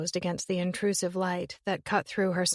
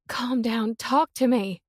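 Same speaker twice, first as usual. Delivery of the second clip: louder, higher urging; urgent older sister; 4 semitones higher, 4 dB louder than usual. Acted. Willow urging her hysterical sister to talk, urgent.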